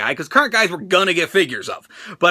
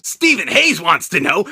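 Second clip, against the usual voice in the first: normal talking voice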